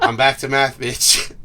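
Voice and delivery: loud exclamation. Real, not acted. Player reacts to the dice roll, loud exclamation. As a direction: blurt it out loudly to the table.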